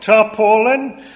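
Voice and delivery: high, loud, slow scoffing Yorkshireman; Yorkshire accent. Retold or imitated. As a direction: scoff the word in broad Yorkshire. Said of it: "Yorkshire accent in the different, uh, character voices".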